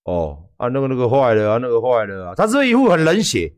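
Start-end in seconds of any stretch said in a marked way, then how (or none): 0.0-2.3 low, offhand mechanic shrugging it off; indifferent mechanic. Retold or imitated. Mechanic telling a car owner it's broken, lightly voiced and indifferent. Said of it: "保养厂的师傅是不是一副就是".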